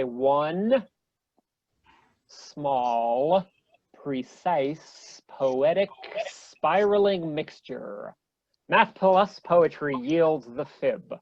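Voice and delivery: slow, spaced-out poem recital; reading a poem. Shown as a demonstration. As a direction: read each line slowly and let it land. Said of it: "I present to you something known as the fib poem".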